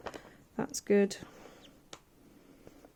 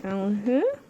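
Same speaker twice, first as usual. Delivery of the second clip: talking with pins in mouth; mumbled around pins held in mouth; mouth full. Real, not acted. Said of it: "I shouldn't talk with pins in my mouth".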